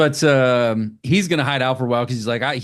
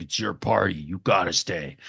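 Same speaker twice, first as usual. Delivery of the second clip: put-on creepy possessed girl; 6 semitones lower, 5 dB quieter than usual. Possessed Susan insists they stay.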